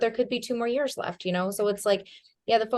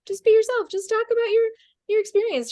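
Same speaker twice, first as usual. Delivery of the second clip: much higher and louder, bright encouraging; high coaching voice; 5 dB louder than usual. Retold or imitated. Telling her nervous friend to just be herself, in a high loud voice.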